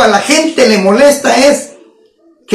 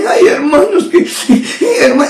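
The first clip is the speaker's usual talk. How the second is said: mock tearful church singer, higher voice; fake crying; 4 semitones higher than usual. Preacher mocking a soloist who pretends to weep, fake crying. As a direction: put on a sobbing voice that breaks off mid-word. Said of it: "finge en la voz que está llorando".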